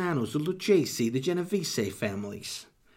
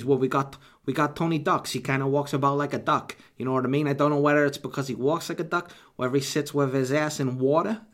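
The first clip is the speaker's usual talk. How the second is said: ordinary talk, nothing marked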